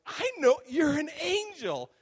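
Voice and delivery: loud, high teasing shout, stretched out; playful retold holler. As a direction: gleefully call them out at high pitch. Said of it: "And so I'm playful".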